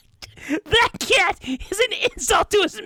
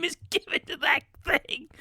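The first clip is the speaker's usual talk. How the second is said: spiteful whisper; 6 dB quieter than usual. Acted. Mom whispering her spite about the cat. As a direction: hiss the insult under your breath.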